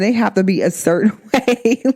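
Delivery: breaking into laughter near the end; amused admission. Real, not acted. Woman admitting she is picky about men, laughing as she finishes. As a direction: let a laugh take over the last words. Laughs at her own pickiness.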